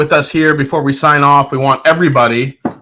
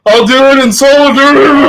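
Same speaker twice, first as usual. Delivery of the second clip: high, loud mock drunk quote; 10 semitones higher, 5 dB louder than usual. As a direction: ham up the friend's words. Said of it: "when he's like".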